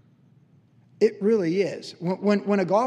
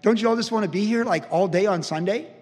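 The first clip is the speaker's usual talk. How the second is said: higher and louder, hammed-up enthusiasm; mock eager pastor; 7 semitones higher, 6 dB louder than usual. Pastor urging staff to work Sundays, mock eager and louder. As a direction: lightly imitate a pastor cheerfully selling extra work. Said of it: "Pastor Chuck expected people to be on staff".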